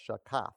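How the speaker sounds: shakaf, second syllable emphasized; stress on second syllable. Shown as a demonstration. Preacher showing the congregation the Hebrew, shakaf stressed on the second syllable. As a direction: put the emphasis on "kaf" in shakaf. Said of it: "the emphasis is on the second syllable".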